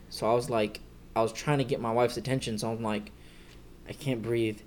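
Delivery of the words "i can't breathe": low, faint plea for help; retold quiet plea. Man weakly trying to get his wife's attention, quiet retold plea. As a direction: softly imitate struggling to speak. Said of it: "I was saying it".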